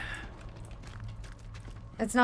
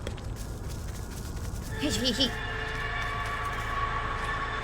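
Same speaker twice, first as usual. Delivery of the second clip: 1 sly wheezy snicker; 11 dB quieter than usual. Pretend. Villain laugh while hunting.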